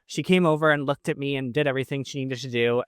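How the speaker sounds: usual speaking voice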